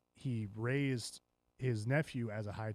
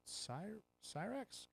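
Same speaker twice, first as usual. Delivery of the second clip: high, soft, tentative question; unsure guess; 9 semitones higher, 10 dB quieter, much slower than usual. Real, not acted. Host groping for a dragon's name, unsure. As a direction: float the half-remembered name upward quietly. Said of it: "what is it?".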